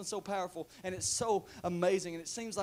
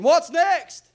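high, loud question thrown back; God hollering in challenge; challenging shout; 12 semitones higher, 16 dB louder than usual. Acted. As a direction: fire it straight back at Moses. God acted shouting back at Moses.

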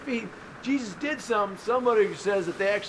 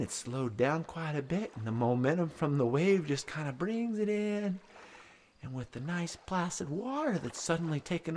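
hushed storytelling; 7 semitones lower, 6 dB quieter than usual. A narrator's reading. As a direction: let it drift in, gently and quietly. Calm drifting narration.

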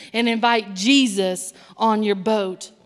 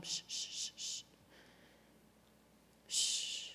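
put-on whispered shh to a baby; soothing a child; 21 dB quieter than usual. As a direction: hush a baby to sleep. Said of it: "pat that little baby".

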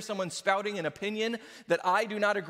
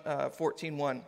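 ordinary delivery

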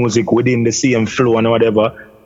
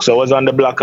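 ordinary talk, nothing marked

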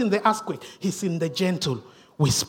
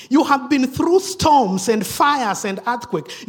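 ordinary talk

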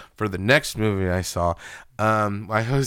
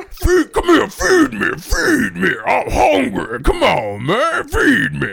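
much higher and louder hungry plant monster; Audrey plant voice; 12 semitones higher, 5 dB louder, slower than usual. Pretend. Teasing the scared kid as the hungry plant, high and loud. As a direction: loom over the kid demanding food. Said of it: "The one that goes, "Feed me".

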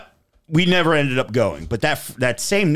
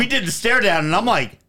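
usual speaking voice

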